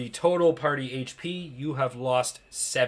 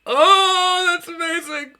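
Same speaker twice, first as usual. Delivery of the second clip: high-pitched gleeful amazement; delighted squeal; 6 dB louder, slower than usual. Real, not acted. Game master reacting to a player's pregnancy roll, high delighted squeal. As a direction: squeal it in delight, far above your usual pitch.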